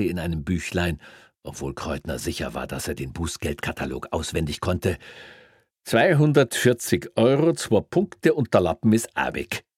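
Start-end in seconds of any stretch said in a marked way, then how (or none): 5.8-9.6 high, loud officious policeman; smug Bavarian cop. Acted. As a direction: deliver the penalty with relish. Greiner voiced in story.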